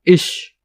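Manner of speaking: इश with a drawn-out shh; sh sound. Shown as a demonstration. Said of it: "श का sound देता है ये". (इश की श ध्वनि)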